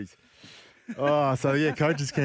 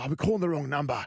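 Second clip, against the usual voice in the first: higher mock of the coach catching the mistake; realizing coach; 6 semitones higher than usual. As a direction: imitate a sudden realization. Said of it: "He goes".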